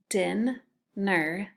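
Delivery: hap-py split into two beats, each syllable tapped. Shown as a demonstration. Teacher to second graders, splitting hap-py into syllables. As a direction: tap out hap-py slowly, one syllable at a time. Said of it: "The next one is hap-py".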